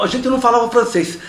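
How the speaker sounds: ordinary delivery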